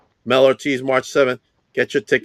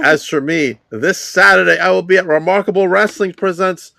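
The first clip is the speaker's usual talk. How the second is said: hype promo read; 8 semitones higher, 4 dB louder than usual. Acted. Hyped event promo read.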